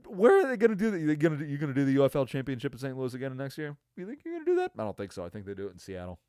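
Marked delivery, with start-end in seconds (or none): 0.1-4.7 high, loud scoffing questions; disbelieving rant at the league; incredulous mocking. Real, not acted.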